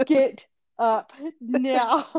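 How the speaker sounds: very slow mock-stern mom through laughter; retold order, laughing. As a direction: stretch out each word while trying not to laugh.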